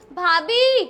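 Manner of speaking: calling out loud. Acted. Calling out to sister-in-law.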